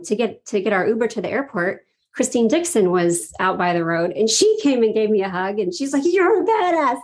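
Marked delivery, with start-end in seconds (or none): 6.1-7.0 high, admiring exclamation; acted gushing compliment, pitched well up; excited praise. Woman hugging her goodbye by the road, retold as excited praise. Retelling another hug and compliment.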